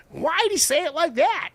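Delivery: high, loud, puzzled mock question; baffled imitation. Host mimics himself confused by British accents, pitched high and puzzled. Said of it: "there's still some words where I'm like".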